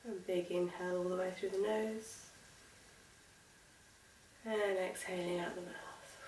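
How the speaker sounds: soft, unhurried breathing cues with long pauses; guided meditation. Acted. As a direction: gently guide them through each breath, leaving space.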